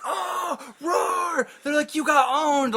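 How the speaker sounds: high-pitched mock crowd uproar; jeering crowd. Host mimics everyone reacting to the kid getting owned, high mock jeering. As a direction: imitate onlookers whooping at a burn.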